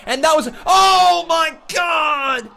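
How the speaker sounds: hyped ringside call, shouting; wrestling commentator. Real, not acted. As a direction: yell the big spots like a commentator. Live play-by-play of match.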